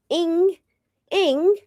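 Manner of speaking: "Ing" squeaked very high, twice; mock ing. Shown as a demonstration. Vlogger mocking the ing sound she must say. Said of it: "say ing at the end".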